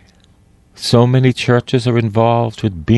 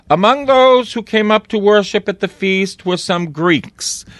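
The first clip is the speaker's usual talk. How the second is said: scripture reading; 9 semitones higher than usual. Acted. Reads the scripture passage.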